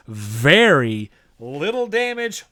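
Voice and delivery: a chant-along very little damage, slow and heavy; stress on very. Shown as a demonstration. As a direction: lean hard on the word very, dragging it out. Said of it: "say it with me now".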